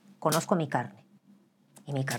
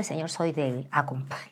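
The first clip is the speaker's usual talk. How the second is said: soft, low plea sinking to a whisper; imitated private, whispered plea; hushed prayer; 4 semitones lower, 5 dB quieter than usual. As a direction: pray under your breath for help. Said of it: "Y yo así".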